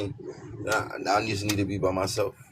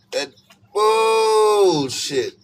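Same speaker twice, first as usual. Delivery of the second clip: loud, very high stretched bullshit; hammed-up high loud refusal; exaggerated; 13 semitones higher, 10 dB louder, much slower than usual. Shown as a demonstration. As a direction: shriek the last word out long. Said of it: "You gotta say it with the exaggerations".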